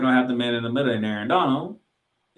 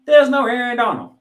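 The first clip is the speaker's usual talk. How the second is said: higher and louder, exasperated; exasperated outburst; 11 semitones higher, 9 dB louder than usual. Real, not acted. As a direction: let the last name burst out. Climax of a list of injured players.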